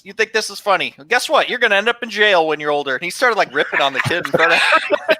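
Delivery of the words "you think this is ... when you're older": high mock scolding; scolding teacher. Teacher berating the kid in front of the class, mock scolding. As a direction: rip into him, hammed-up. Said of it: "he goes".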